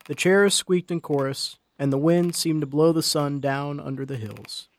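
plain reading voice

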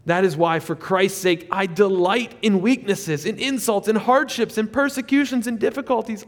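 rising, fervent list; building scripture reading. Real, not acted. Reading Paul with rising intensity.

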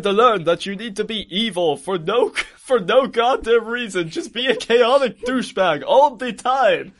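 high, hammed-up exasperated father; mock outrage, drawing out the last words; scolding villain dad. Acts Dracula scolding his son.

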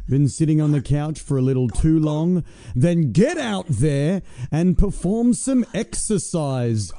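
hammed-up infomercial voice; ad read. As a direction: sell it like a cheesy fitness ad.

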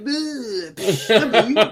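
cartoonish mock-combat outburst; mock action-movie soldiers. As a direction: ham up a silly war movie, breaking into laughs. Imitating a silly war movie.

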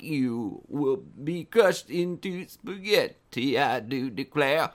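slow computer voice with a Southern twang; halting robot turned Southern gentleman; Stephen Hawking. Acted. Host imitates a computer made a Southern gentleman, slow robotic drawl. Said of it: "Stephen Hawking's computer voice suddenly decided to make him a Southern gentleman".

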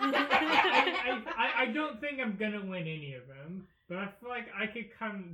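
laughing through the words. Real, not acted. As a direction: giggle while talking. Laughing at fighting a moose.

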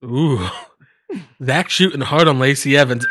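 normal talking voice

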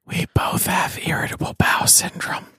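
right in your ear; whispering. Acted. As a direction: whisper close to the mic. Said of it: "and you whisper".

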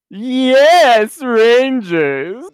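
high, loud, hammed-up villain call; Divatox. Podcaster doing a villain's call to the Rangers, hammed-up impression. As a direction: draw it out in an exaggerated cartoon voice. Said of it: "was my Divatox impression".

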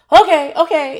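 loud, high, overeager okay; hammed-up panicky agreement; flustered acceptance. Relieved, flustered reply to inspector.

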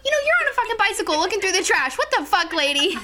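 high, fast indignant rant; retold heated tirade; ranting imitation. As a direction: rattle it off like an outraged drunk friend. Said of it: "she's yelling".

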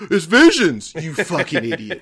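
loud, high burst of annoyance; exasperated yell. Retold or imitated. Fan snaps at the concertgoers beside him, exasperated shout. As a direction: blurt it out like you've lost patience. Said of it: "the guy finally goes".

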